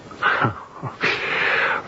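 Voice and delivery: short bitter laugh; a dry laugh at the captain's expense. Acted. Asked about his absent captain.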